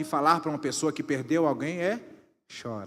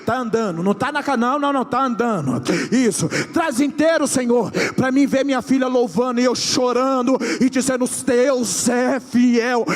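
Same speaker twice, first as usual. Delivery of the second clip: rising to loud, high prophetic cries; prophesying; 8 semitones higher, 12 dB louder than usual. Acted. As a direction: build the prophecy to a shout. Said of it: "eu tô profetizando". (profecia crescendo até o grito)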